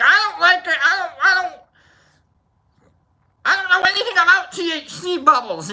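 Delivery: mocking falsetto impression of a complainer; mocking imitation. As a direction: put on a high squeaky mimic of someone protesting. Mimicking someone's complaint, laughing.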